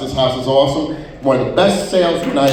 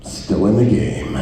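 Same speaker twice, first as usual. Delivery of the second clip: low groggy voice; 9 semitones lower than usual. Retold or imitated. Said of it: "it's like".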